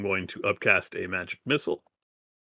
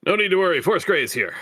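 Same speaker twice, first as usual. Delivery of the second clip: heroic announcement; 10 semitones higher, 6 dB louder, faster than usual. Acted. Player voices character reassuring enemy.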